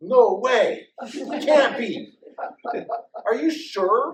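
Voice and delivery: loud, high burst of disbelief; astonished disbelief. Retold or imitated. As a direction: shout it out, thrilled she can't believe it. Mother learns baby is daughter.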